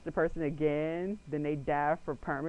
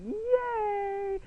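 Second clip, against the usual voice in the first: high, thin half-hearted yay; winning the art contest; mock cheer. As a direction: cheer with fake enthusiasm. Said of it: "So I was like".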